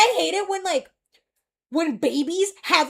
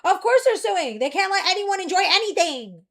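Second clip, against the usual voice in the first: higher, louder indignant rant; 6 semitones higher, 4 dB louder than usual. Real, not acted. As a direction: vent your outrage. Ranting about Nintendo lawsuit.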